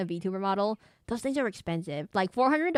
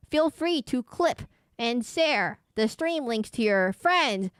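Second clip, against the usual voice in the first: slow, lilting, pitched-up plea to viewers; promotional appeal; 5 semitones higher, 4 dB louder, slower than usual. Acted. Streamer urging viewers to share, slow promo pitch. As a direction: spell it out slowly like a promo.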